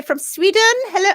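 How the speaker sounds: Sweden said in a playful put-on accent; greeting with Sweden in a mock accent. Real, not acted. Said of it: "why do I say Sweden in that kind of accent".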